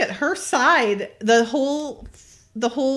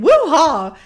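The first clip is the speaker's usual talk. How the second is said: loud, higher hammed-up whoop; mock whoop; 6 semitones higher, 8 dB louder than usual. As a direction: let out a playful war whoop. Retelling a wild truck ride.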